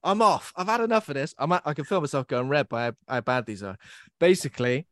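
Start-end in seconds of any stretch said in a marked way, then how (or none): 0.0-1.3 high, loud pretend walk-out; mock exasperated. Host gives up on own awful puns, mock exasperated. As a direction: throw up a mock protest, pitched high.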